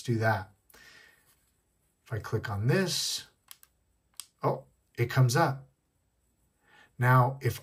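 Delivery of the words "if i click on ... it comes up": ordinary delivery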